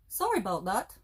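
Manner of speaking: lightly imitating a Canadian accent, rising at the end; a hint of a Canadian accent on a sorry; Canadian movie quote. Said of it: "That's their quote".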